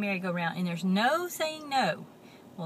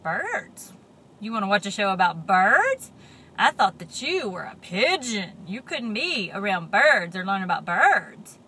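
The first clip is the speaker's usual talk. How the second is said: mock surprise; 2 semitones lower, 7 dB louder than usual. Teasing Pigeon with mock surprise.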